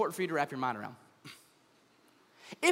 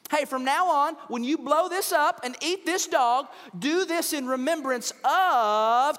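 louder, grandly slowed proclamation; crazy Uncle Ted; 6 semitones higher, 5 dB louder, slower than usual. Pretend. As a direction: ham it up like a tipsy uncle making a toast. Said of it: "Your crazy Uncle Ted".

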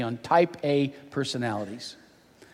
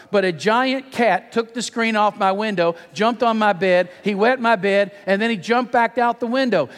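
put-on higher-pitched child's tall tale; mock tearful; 6 semitones higher than usual. Daughter explaining wet bed to dad, mock tearful pleading. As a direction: plead like a little girl, lightly pretending tears. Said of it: "she sobbed".

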